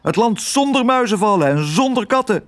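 high, sustained excited character voice; high-pitched, wide-eyed eagerness; eager adventurer. Acted. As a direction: declare a grand dream in a high voice.

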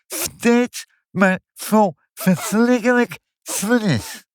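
high wailing voice with every "s" slurred to "f", as in "vlif"; distressed lisp. Acted. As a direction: lament with a heavy lisp, turning "feest" mushy and dragging it out. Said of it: "Ik kan de F niet zeggen".

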